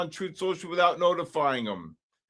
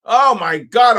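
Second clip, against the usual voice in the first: excited shout; 6 semitones higher, 10 dB louder, slower than usual. Real, not acted. Market spikes, shouting.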